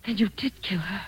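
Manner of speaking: stunned, whispered realization; frightened whisper. Acted. Wife whispering to her husband, realizing he killed before. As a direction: barely voice it, horrified.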